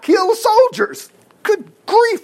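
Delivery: high, loud exclamation; amazed. Real, not acted. Preacher marvelling that the flames killed soldiers, high and amazed.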